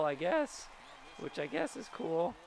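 ordinary talk, nothing marked

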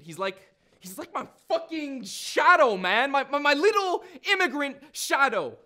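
animated street-talking character, rising in irritation; Nigel, agitated storyteller. Acted. Nigel telling of the immigrant kid shadowing him, agitated. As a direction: tell it like a riled-up young man.

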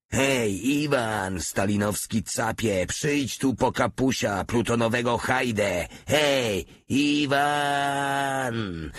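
high, drawn-out calling out; full-voice roar. A narrator's reading. Soldier taunts the enemy across the forest, roaring high. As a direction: holler out to someone far off, stretching the final name. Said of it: "ryknął na cały głos".

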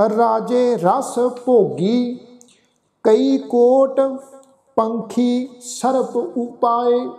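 slow, measured verse recitation; deliberate chant-like reading with pauses; scripture recitation. Shown as a demonstration. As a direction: recite line by line with held final syllables.

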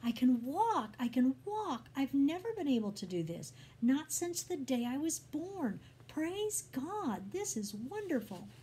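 high, elated exclamations; joyful shouting. A narrator's reading. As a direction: cry out in amazed jubilation. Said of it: "jumping and laughing and shouting".

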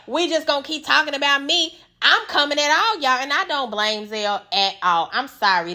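usual speaking voice

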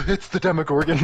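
excited gasp. Real, not acted. Excited recognition.

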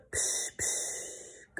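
unvoiced psh, psh; imitated spooky sound; breathy psh noise. A narrator's reading. Imitating the noise under bed.

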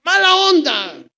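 high, slow hammed-up whine; mock tearful. Marta correcting herself to Jesus, in a mock whine. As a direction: drag it out like a sulky complaint. No, mala copa no.

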